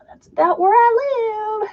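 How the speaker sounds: high, drawn-out singsong; playful singsong. Real, not acted. Crafter telling chat where she lives, playful high singsong. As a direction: let it climb high and stretch out. Playful answer after the impression.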